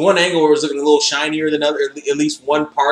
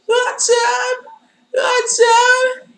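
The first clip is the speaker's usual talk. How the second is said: high mock greeting; much slower than usual.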